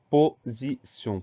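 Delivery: "position" slowly split as po-zi-sion; syllable by syllable. Shown as a demonstration. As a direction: dictate "position" in three separate, emphatic syllables. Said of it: "Première syllabe po".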